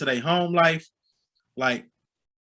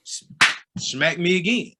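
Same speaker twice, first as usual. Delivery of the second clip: normal talking voice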